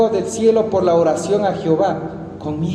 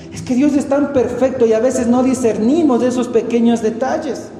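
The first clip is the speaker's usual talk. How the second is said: usual speaking voice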